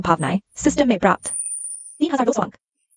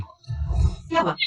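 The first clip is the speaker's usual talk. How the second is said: slow, drawn-out whisper with the question lifting at the end; a hushed whisper, stretched out slowly; 8 semitones higher, 6 dB quieter, much slower than usual. Acted. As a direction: breathe the question so nobody overhears. Sneaking, rehearsing an excuse.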